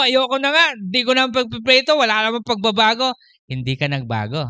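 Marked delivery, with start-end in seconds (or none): 0.0-3.1 higher, louder fed-up whine; mock exasperated quitter; fed-up complaint. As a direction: complain like someone ready to quit praying. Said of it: "O sabi mo".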